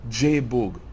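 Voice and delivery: normal talking voice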